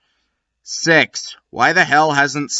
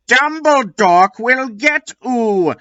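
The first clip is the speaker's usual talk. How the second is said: voiced Draco; 10 semitones higher than usual. Draco shouting a threat.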